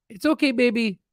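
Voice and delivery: high pet voice, reassuring; soothing the dog. Real, not acted. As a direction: coo to calm a scared puppy.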